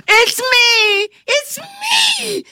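high joyful shouting; exultant shout. A narrator's reading. Caleb shouts to Kate that he is himself, exultant shout. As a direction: burst out with joy at being human. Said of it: "he shouted exultantly".

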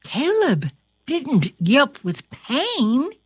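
ordinary delivery, nothing marked

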